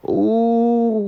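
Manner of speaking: loud, high shocked ooh. Real, not acted. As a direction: let out a scandalized ooh. Reacting to snitching.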